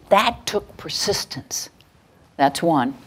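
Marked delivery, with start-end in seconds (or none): 0.1-1.7 put-on soft whisper for one child; whispered praise. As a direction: whisper it as a private compliment. Teacher voices private praise.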